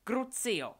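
high, very loud spell shout; cried out. A narrator's reading. Delphi hurls a torture curse at Scorpius, shouted. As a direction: scream the curse out with force. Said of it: "vykřikla Delphi".

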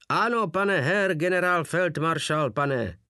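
high-pitched soldierly acknowledgement; military shout. A narrator's reading. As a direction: sing out the rank like a drilled soldier. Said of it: "zakřičeli Porta a Wolf jako jeden muž".

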